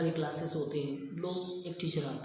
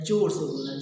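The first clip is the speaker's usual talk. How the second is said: says जोर से बोलना है much louder; 8 dB louder than usual. Shown as a demonstration. Teacher demonstrating to students how to speak, loudly. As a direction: show them loud speech. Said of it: "पर आपको कैसे बोलना है?".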